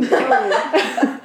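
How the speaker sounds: slow, laughing through it. Real, not acted. Joke taken back, laughing.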